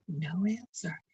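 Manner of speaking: usual speaking voice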